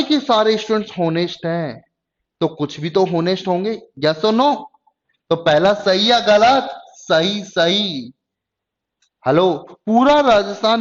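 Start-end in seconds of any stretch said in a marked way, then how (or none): none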